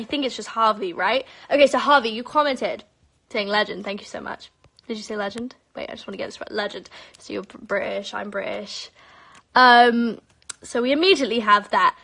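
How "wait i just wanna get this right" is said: whispered aside. Real, not acted.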